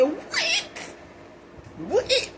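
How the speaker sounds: high, startled double what; high-pitched shocked what. Retold or imitated. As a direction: squeal it out in disbelief. Shocked reaction retold as quote.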